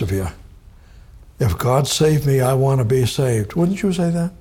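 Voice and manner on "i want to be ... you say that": ordinary talk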